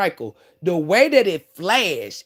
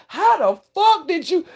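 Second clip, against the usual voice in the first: much higher astonished cry; be stunned she got out; drunk friend amazed; 10 semitones higher, 3 dB louder than usual. Retold or imitated. Drunk neighbor Ben amazed at her escape, astonished.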